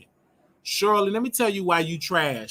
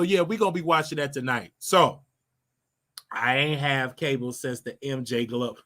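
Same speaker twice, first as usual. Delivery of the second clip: usual speaking voice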